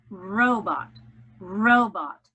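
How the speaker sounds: "robot" as ROH-bot, first syllable loud; stress on first syllable of robot. Shown as a demonstration. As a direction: punch the first half of "robot". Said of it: "accent is on the first syllable".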